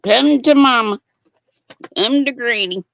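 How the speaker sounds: loud happy call; loud doting come to mama. Real, not acted. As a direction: scoop it up with glee. Grabbing found hook.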